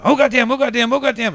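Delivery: high, loud imitation of a panicked dad; frantic cursing. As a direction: curse like you're hopping up and down. Acts out dad hopping in panic.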